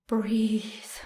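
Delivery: unvoiced gasping whisper; panicked whisper; breathless whisper. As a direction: whisper as if air is gone.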